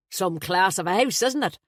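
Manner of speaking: a flustered, jovial Dubliner blurting out; Irish best man. Acted.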